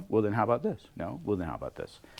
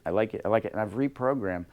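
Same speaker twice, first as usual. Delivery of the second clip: usual speaking voice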